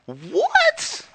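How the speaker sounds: a sudden high disbelieving squeak; stunned what. Real, not acted. Telling the interviewer about the movie, a shocked high "What?". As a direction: let the disbelief jump right up.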